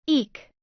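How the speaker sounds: sudden high startled squeal; a quick embarrassed shriek; screech. A narrator's reading. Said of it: "Sakura screeched".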